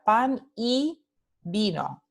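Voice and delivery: "pan y vino" slowly, with a pause on each side of "y"; word-by-word example. Shown as a demonstration. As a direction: set the "y" apart in "pan y vino". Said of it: "letra Y". ("pan y vino" despacio, palabra por palabra)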